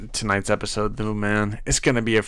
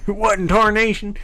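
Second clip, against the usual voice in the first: higher, hammed-up Texan accent; 9 semitones higher than usual. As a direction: play a Texan cowboy for a beat. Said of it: "the Texan voice or whatever".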